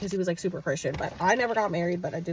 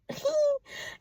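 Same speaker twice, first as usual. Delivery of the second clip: high burst of laughter; 3 dB quieter than usual. Real, not acted. Laugh alone.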